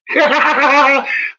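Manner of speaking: loud, high burst of laughter. Real, not acted. Telling how the doctor backed him, loud laugh. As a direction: crack up loudly. Laughing at his story's payoff.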